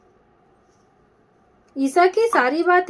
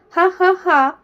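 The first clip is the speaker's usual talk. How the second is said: slow mocking villain's laugh; gloating ha ha ha; 3 semitones higher than usual. A narrator's reading. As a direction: laugh like a gangster savoring his win. Villain gloats over girl.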